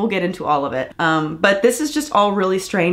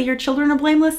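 sarcastic echo; 7 semitones higher, faster than usual. Retold or imitated. Vlogger mocking the dad's obvious statement, sarcastic echo. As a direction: echo it, pitched high and mocking.

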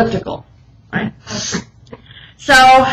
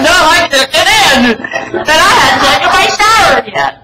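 laughing; 6 semitones higher, 3 dB louder than usual. Retold or imitated. Stuck, laughing at herself.

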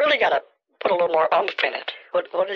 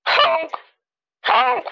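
very high loud honk; swan honk; 4 dB louder than usual. Shown as a demonstration. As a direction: honk with force from the gut. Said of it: "something like that".

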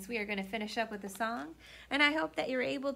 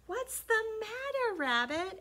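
high cat voice; 12 semitones higher than usual. Acted. Cat character asks.

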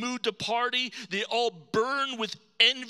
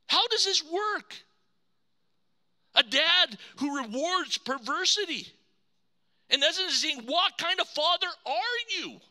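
higher, bitter complaining son; indignant older brother; 7 semitones higher than usual. Acted. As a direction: protest like the wronged eldest son. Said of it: "he's angry".

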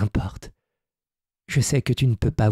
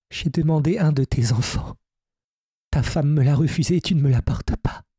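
fairy Zia; 5 semitones higher than usual. Acted.

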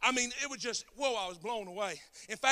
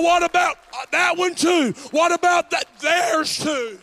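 louder, higher, drawn-out preaching; full-force preaching; 7 semitones higher, 17 dB louder, slower than usual. Real, not acted. Preacher closing the sermon to his congregation, full force. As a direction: drive the message home to the church.

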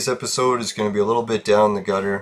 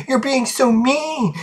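mock yelling; 13 semitones higher, slower than usual. Roommate accusing the speaker during an argument, mock high-pitched yell. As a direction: imitate a yelled complaint in a slow, very high voice. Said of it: "she starts yelling at me".

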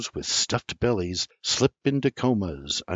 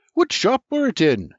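high, louder question; father quizzing his son, raised voice; Junior's query; 13 semitones higher, 4 dB louder than usual. A narrator's reading. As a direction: ask it eagerly, pitch way up.

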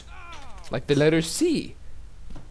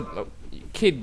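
ordinary delivery

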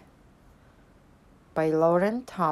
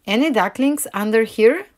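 raised, louder searching question; moderately high, loud call; 4 semitones higher, 5 dB louder than usual. A narrator's reading. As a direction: call to hidden ducklings, pitched up. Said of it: "she called".

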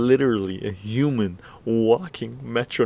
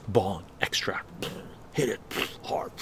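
whispered; 3 semitones lower, 7 dB quieter than usual. Shown as a demonstration. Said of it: "whispered step".

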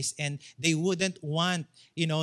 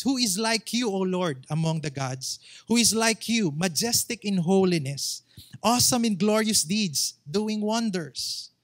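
louder, lifted preaching of the verse; preacher declaiming Exodus with rising force; forceful scripture reading; 3 semitones higher, 5 dB louder than usual. Real, not acted. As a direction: proclaim the verse to the congregation.

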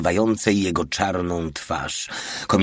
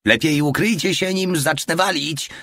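high, raised warning from the hatch; warning call; 9 semitones higher than usual. Acted. Porta warns from driver hatch.

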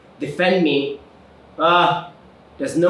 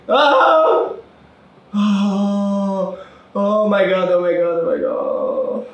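being chased; 6 semitones higher, 4 dB louder, much slower than usual. Real, not acted. Just escaped the chase.

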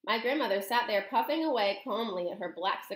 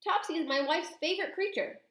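higher-pitched protest; distressed cry; 8 semitones higher than usual. A narrator's reading. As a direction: plead the cat's case in dismay. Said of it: "cried Mister Jenkins".

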